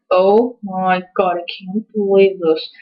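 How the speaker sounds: slow, drawn-out disbelief; mortified. Retold or imitated. Daughter watching dad's outburst, retold slow mortification. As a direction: drag the words out in embarrassment.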